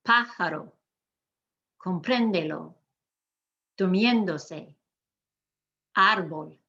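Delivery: spaced list, "Durmiéndose", "Gómez" stressed on the accented vowel; accented syllable stressed. Shown as a demonstration. Teacher asking learners to repeat, "Teléfono" stressed on LE. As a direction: model each word, "Pájaro" hit on PA. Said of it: "Repeat after me".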